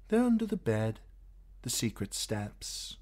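put-on high, light young woman's voice; Shay's voice. A narrator's reading. Shay chattering to Tyrion in bed, high and eager.

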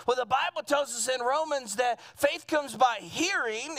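usual speaking voice

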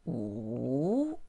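Wu dipping then rising; third tone wu. Shown as a demonstration. Teacher models the dipping third tone. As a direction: drop Wu low, then bring it up. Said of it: "falling, rising".

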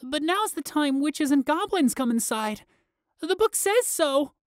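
put-on high, scared little boy; small frightened child voice; tiny quivering voice. A narrator's reading. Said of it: "Flix's tiny voice quivered".

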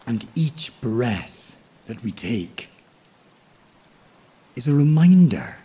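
plain reading voice

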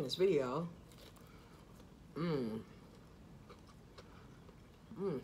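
talking while chewing food; muffled by food in mouth; chewing with mouth full. Real, not acted. Seen 2: Bites wedge at -2; mouth shut, jaw working, holds piece throughout.

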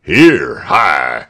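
low, loud challenge; soldiers' shout. A narrator's reading. Soldiers challenge Tell in the meadow, low shout. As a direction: call out sharply to halt someone. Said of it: "shouted the soldiers".

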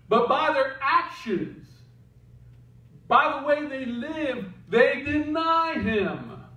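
slow, emphatic preacher voice; preaching. Acted. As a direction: lean into each phrase.

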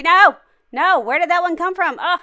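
loud startled cry; alarmed shout. Real, not acted. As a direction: shout in shock. Mannequin attacks.

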